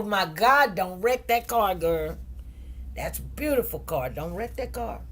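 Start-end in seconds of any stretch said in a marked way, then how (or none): none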